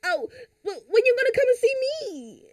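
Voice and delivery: high, hurt little mock whine; Ashley. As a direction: plead like a let-down girlfriend, pitched high. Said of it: "I'll be Ashley".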